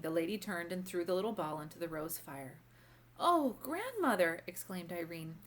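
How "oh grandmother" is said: high, slow cry of dismay; a child's drawn-out alarmed cry. A narrator's reading. Irene cries out at fire.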